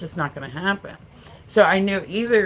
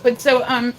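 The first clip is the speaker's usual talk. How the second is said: normal talking voice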